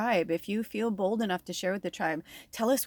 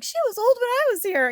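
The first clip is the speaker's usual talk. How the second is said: much higher, amazed mother's voice; mom's amazed voice; 5 dB louder than usual. Retold or imitated. Mom marvelling that the old teacher is still working, in a much higher amazed voice. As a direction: squeak it out like an astonished mom.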